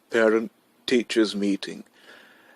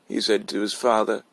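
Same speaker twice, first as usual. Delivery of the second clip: plain reading voice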